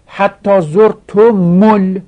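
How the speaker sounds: زرتم ال slow, high and loud, linked as "zortomul"; damma on mim. Shown as a demonstration. Teacher reciting the verse to show damma, زرتم ال as "zortu-mul". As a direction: slowly add "u" after the m of زرتم, into ال. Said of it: "میم را ضمه دادن".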